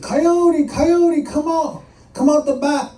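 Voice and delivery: high, loud pleading call; desperate begging. Acted. Monster desperate for Coyote to leave, high begging cry. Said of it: "he was getting desperate".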